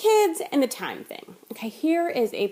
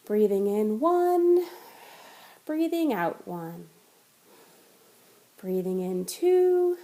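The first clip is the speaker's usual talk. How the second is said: guided breath counting; slower than usual. Shown as a demonstration. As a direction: ease the listener into a slow count. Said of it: "So you start".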